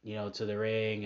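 normal talking voice

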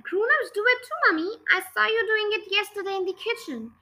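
a hint of a sly young daughter; child's voice. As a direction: Matilda teasing her mother in a high child's voice.

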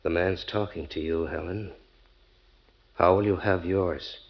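low, controlled prompting; soft, deliberate question with pressure under it; quiet menace. Acted. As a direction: press for an answer, keeping the voice down. Husband quietly pressing wife to answer.

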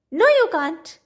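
put-on high, loud, cross old woman cutting in; cross interruption. A narrator's reading. Old woman cuts off the stranger, cross and high. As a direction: cut him off crossly. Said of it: "the cross voice interrupted".

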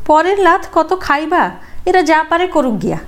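high, scornful urging; scornful wife. Acted. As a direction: sneer at taking their kicks. Wife urges husband, scornful. (স্বামীকে বউয়ের তাচ্ছিল্যভরা কথা)

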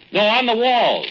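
loud, high shout down the cave; calling out. Acted. Friend shouting to Lucille far back in the cave, loud high call. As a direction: correct her at full voice.

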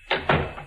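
quiet breathy laugh under the breath; soft gloating chuckle. Acted. Chigger enjoying Danny's terror, soft gloating chuckle. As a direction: chuckle at his fear.

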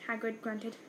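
plain reading voice

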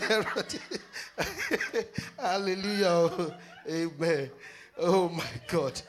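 laughing through hallelujahs, high giggles. Real, not acted. Preacher laughing at her own confusion, giggling. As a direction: laugh helplessly between words.

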